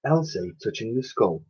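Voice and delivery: ordinary delivery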